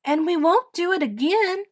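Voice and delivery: high-pitched, emphatic promise; earnest declaration. A narrator's reading. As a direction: declare it firmly as a child. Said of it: "declared Edith".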